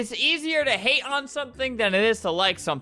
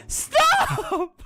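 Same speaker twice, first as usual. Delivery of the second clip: imitated yell. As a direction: mimic a player yelling, pitched way up. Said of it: "she was yelling at some other girl".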